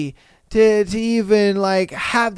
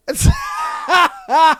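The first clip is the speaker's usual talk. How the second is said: real high-pitched cackle of disbelief; high incredulous laugh. Real, not acted. Host reacting to a wild confession, high incredulous laugh.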